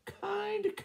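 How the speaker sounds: very high, sweet trill on kind. Acted. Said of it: "trilled Missus Chicken".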